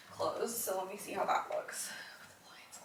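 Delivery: hushed whisper to herself. Real, not acted. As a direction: whisper the aside. Quiet aside in nursery.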